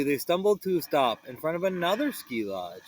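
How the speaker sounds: usual speaking voice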